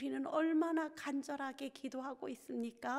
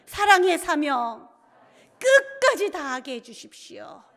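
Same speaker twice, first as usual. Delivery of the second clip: loud group prayer; 15 dB louder than usual. Acted. Pastor leads teachers in a shouted prayer. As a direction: cry it out so the congregation follows. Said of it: "한번 따라 하실까요?".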